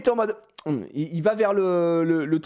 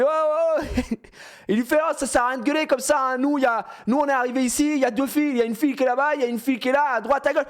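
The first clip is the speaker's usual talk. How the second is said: heated mock protest; 8 semitones higher than usual. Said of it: "qui commence à péter un plan".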